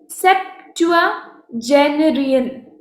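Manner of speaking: सेप्टुआजेनरियन spelled out slowly, syllable by syllable; slow careful pronunciation. Shown as a demonstration. Teacher sounding out सेप्टुआजेनरियन slowly for students. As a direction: draw out सेप्टुआजेनरियन for the class. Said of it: "इसका pronunciation आप देखो".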